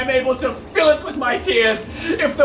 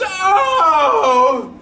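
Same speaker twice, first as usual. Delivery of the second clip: mock moan; 3 semitones higher than usual. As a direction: wail it like a silly sister. Sister's moan acted out.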